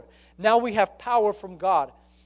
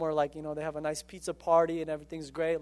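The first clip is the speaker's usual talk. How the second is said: ordinary talk, nothing marked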